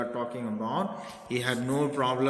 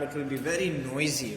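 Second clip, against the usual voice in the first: ordinary talk, nothing marked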